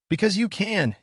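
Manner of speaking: a touch of a shout. A narrator's reading. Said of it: "he shouted".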